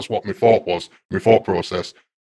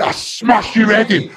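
threatening shout; 12 semitones higher, slower than usual. Retold or imitated. Patient threatening the psychiatrist, furious shout. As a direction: spit the threat out at him.

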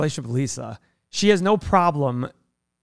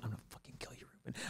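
whispered threat; 4 semitones lower, 27 dB quieter, much faster than usual. Retold or imitated. Silent threat across tennis court.

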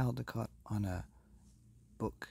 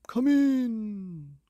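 high, loud bellowing king; booming king; 13 semitones higher, 10 dB louder than usual. Acted. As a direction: boom it out as a jolly king. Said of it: "cried the king with a trout".

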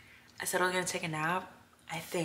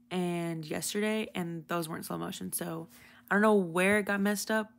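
usual speaking voice

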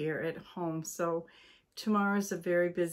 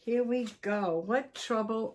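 plain reading voice

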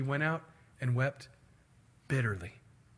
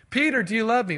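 raised, louder, pressing question; acted insistent asking; 6 semitones higher, 6 dB louder, faster than usual. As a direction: ask it firmly, pitched up. Acts Jesus asking a second time.

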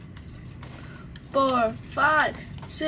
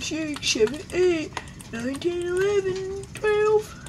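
counting higher and higher; rising excitement; 7 semitones higher than usual. Real, not acted. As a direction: climb up with each number.

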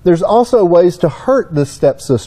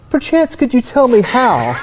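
higher, hammed-up politeness; mock-refined, pinky up; 6 semitones higher than usual. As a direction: play at being oh so refined, amused. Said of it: "You know, my pinky up".

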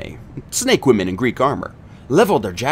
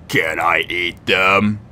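lower, slow giant voice; hungry giant; 6 semitones lower, much slower than usual. A narrator's reading. The giant asks Kelly for a meal, low and slow. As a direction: drag it out like a dim, hungry brute.

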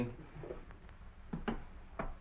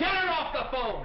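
higher, louder angry shout; 12 semitones higher, 4 dB louder than usual. Real, not acted. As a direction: bark it over the noise to get her off the line. Interrupted on urgent phone call.

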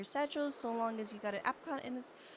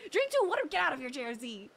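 mock scolding; 2 semitones higher, 4 dB louder than usual.

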